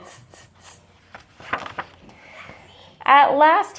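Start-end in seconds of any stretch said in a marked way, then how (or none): 0.0-0.8 unvoiced tss tss tss, copying; mock the peddler with a breathy tsk; whispered monkey tsk. A narrator's reading. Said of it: "back at him and said".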